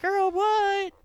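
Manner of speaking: high, loud squeal of disbelief; shocked disbelief. Retold or imitated. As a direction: react like you can't believe your ears. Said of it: "And I'm like".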